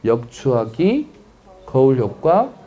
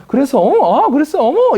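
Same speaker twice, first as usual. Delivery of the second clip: high-pitched, animated listening; matching high tone; 11 semitones higher, 4 dB louder than usual. Acted. Listener follows a rising voice, high and animated. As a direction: react high to match an excited speaker. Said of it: "거기서 말이 높아지면은".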